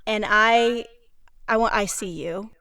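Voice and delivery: normal talking voice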